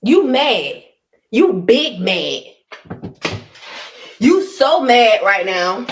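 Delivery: slow, drawn-out taunt. Acted. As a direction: savor every word of the put-down. Taunting the angry woman.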